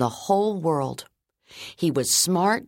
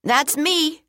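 high, eager schoolboy; boy eager to speak up; eager kid announcing; 13 semitones higher, 3 dB louder than usual. Acted. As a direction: pipe up proudly from the desk. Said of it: "Ben announced from his desk".

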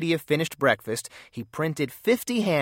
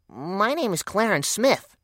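lightly voiced young boy, timid; a shy little kid asking for help; small boy's voice; 7 semitones higher than usual. Small boy Clarence speaks.

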